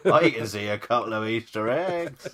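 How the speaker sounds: lightly imitated West Country accent; a hint of West Country accent. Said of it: "says it again in a West Country accent".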